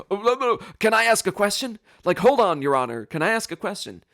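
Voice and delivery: high, loud, urgent plea; frantic interruption. Retold or imitated. Defendant stopping the judge's gavel, frantic plea. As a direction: jump in before it's too late.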